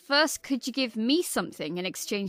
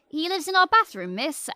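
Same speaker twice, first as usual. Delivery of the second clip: put-on quick, higher, louder kid's answer; 6 semitones higher, 4 dB louder than usual. A pupil answering teacher, quick and high. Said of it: "And this kid says".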